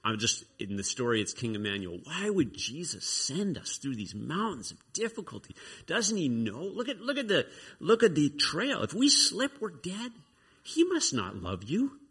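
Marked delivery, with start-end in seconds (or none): 2.0-12.0 high-pitched, incredulous questioning; badgering doubts. Woebegone pounding the traveler with discouraging thoughts, badgering and high. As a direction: pester with alarmed complaints, pitched up. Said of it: "pounding him with these thoughts".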